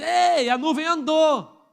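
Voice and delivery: slow, loud mock call of the alarm; retold shout. Preacher retelling a lookout's cry, mock shout. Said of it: "alguém grita".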